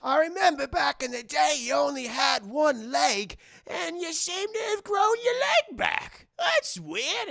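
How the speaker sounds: put-on high, loud old rat voice; hammed-up bewildered old pirate; ancient cabin boy. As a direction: puzzle it out as a doddering sailor. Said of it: "he's like".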